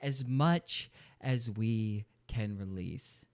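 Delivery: slow, low, soft after the outburst; drawn-out mocking quote. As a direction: drop down and spell out each word. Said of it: "What do you mean?".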